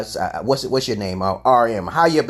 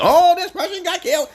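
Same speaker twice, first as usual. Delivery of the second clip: high mocking whine of fake grief; mock sob-story. Mocking news watchers who get emotional, high whiny tone. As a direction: parody someone gushing over the news, pitched high.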